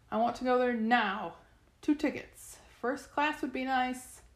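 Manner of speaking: high, insistent demand; calling out. A narrator's reading. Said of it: "she called and raised her staff".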